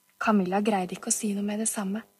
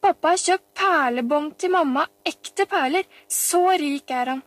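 put-on higher, louder little-girl voice; boastful child; 6 semitones higher, 4 dB louder than usual. A narrator's reading. Pia-Pia brags about pearls for mamma, boastful child. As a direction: show off at full childish pitch.

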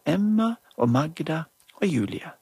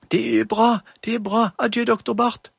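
much higher, louder pleading mum; urgent mother; 8 semitones higher, 5 dB louder than usual. Acted. Mother begs doctor to come, high and urgent. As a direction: urge the doctor to hurry. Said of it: "Det var Julie som snakket for mammen".

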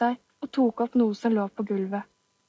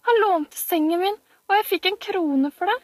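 put-on insistent child; 9 semitones higher than usual.

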